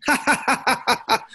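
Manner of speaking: high mocking ha-ha laugh, imitating the wife; imitated wife's laugh. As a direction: cackle high as the wife who saw it coming. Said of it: "all of a sudden I heard".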